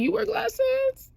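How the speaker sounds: very high, slow questioning; high-pitched incredulous question; retold surprise. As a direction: stretch it upward in mock astonishment.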